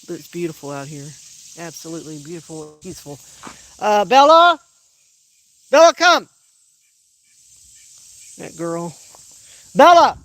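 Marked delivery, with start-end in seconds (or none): 3.8-6.3 loud, high shout across the yard; calling the dog. Real, not acted. Owner shouts for dog Bella to come, loud call. 9.7-10.2 loud, high shout across the yard; calling the dog. Real, not acted. Owner shouts for dog Bella to come, loud call.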